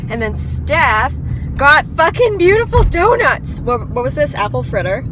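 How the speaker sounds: loud, high thrilled exclaim; hyped. Real, not acted. Student after her final, loudly thrilled about donuts. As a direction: burst out with delight at the donuts. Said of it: "now I'm hyped".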